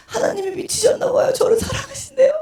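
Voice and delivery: very high, sustained wail; mock tearful wailing; imitated sobbing. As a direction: bawl it out like a bewildered drunk. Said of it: "엉엉 울고 있잖아요".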